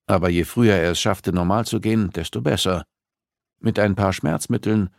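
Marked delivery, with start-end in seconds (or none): none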